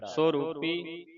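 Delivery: slow, measured verse recitation; slow devotional reading; scripture recitation. Shown as a demonstration. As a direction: recite with long drawn-out vowels.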